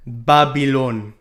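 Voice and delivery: put-on slow drawn-out Jamaican Babylon; Jamaican accent. Shown as a demonstration. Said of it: "but in a Jamaican accent".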